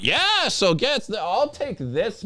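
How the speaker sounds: high, slow mocking voice; mock a self-important customer ordering; cocky customer imitation.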